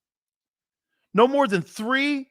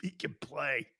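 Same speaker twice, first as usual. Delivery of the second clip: lower, softer mock impression of a pal; imitating a colleague; 4 semitones lower, 7 dB quieter than usual. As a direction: do your colleague's low-key praise, trailing off. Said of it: "He goes like this".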